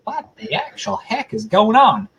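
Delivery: high, slow imitation American accent; mock American accent. Host imitating super excited followers, in a mock American accent. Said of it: "Maybe not in an American accent".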